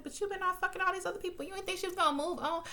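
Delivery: high and fast, half-laughing disbelief; incredulous call-out. Retold or imitated. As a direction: throw her hypocrisy back at her. Calling out Bette's hypocrisy.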